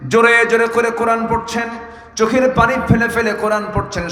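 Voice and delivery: high, ringing sermon narration; full-force preaching. Real, not acted. Preacher telling of Abdullah reciting Quran, impassioned preaching. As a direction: lift the narration into a high chant.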